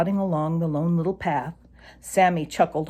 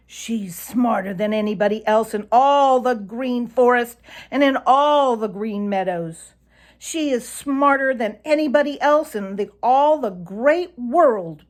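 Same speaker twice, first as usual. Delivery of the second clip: loud proud brag, higher, slowing at the end; boasting; 6 semitones higher, 5 dB louder than usual. A narrator's reading. Reddy brags about Granny Fox to Sammy, loud and proud. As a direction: puff up and brag, louder. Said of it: "boasted Reddy".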